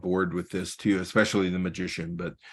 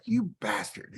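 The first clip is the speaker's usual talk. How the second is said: high, soft mock-accusing jab; playful jealous curse, not real anger; mock outrage; 13 semitones higher, 5 dB quieter than usual. Envious buddy mock-cursing the photographer's luck, high and soft. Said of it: "he's like".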